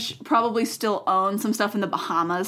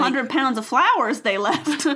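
mock Texan accent; 4 semitones higher than usual. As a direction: put on a hammy Southern accent. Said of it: "that's not a Texan accent".

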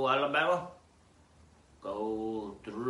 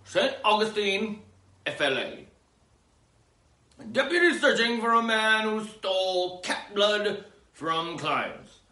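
news read; 11 semitones higher, 8 dB louder than usual. Acted. Reading a news item aloud.

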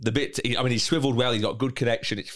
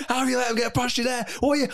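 higher, fast, sing-song mock rant; angry yell at defender; 12 semitones higher, faster than usual. Mate imitating angry fan at defender, mock furious. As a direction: rant at the defender in a hammed-up fury. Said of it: "he was so angry".

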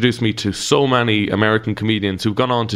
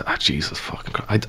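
exasperated groan; 4 semitones lower, 5 dB quieter than usual. Acted. Bank man exasperated.

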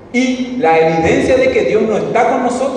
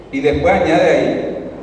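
normal talking voice

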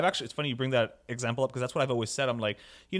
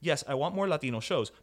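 ordinary talk

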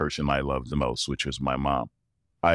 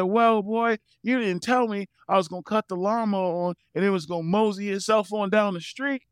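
put-on baffled old dad. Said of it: "He said".

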